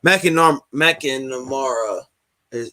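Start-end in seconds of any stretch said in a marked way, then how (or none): 0.7-2.0 McNamara sounded out slowly as "mack-in-a-MAR-a"; slow, careful McNamara with an open MAR; careful pronunciation. Real, not acted. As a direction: feel out McNamara syllable by syllable. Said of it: "what is how you say it".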